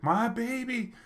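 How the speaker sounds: high wailing mother, lightly done; imitated hysterical crying. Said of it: "she was hysteric".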